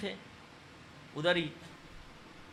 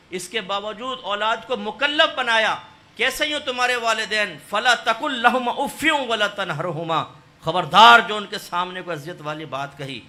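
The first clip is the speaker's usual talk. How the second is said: loud, fervent sermon, voice raised high; preaching at full force; 3 semitones higher, 9 dB louder than usual. Acted. Preacher warning children about their parents, loud fervent preaching. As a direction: thunder it out to the congregation.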